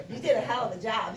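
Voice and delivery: normal talking voice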